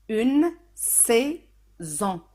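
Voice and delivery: very slow, UNE SAISON in separate syllables; slow example word. Shown as a demonstration. Teacher modelling an example for learners, very slow. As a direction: draw out each syllable.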